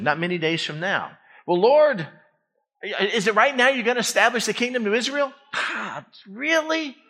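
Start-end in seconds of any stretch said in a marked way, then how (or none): 1.5-5.3 higher-pitched, hurried question; eager disciple. Acted. Disciples to Jesus about restoring Israel's kingdom, eager questioning. 5.5-7.0 high, incredulous "really?"; acted exasperation. Jesus answering disciples who missed his point, mock exasperation.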